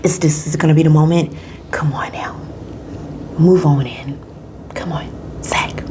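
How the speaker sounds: urgent whispering; breathless willing-on whisper; tense whisper. Retold or imitated. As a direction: whisper on the edge of your seat. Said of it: "you're like".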